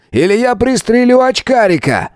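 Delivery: high-pitched threatening cry; barked threat. Acted. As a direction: bark it as an ultimatum. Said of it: "гаркнул Свободин".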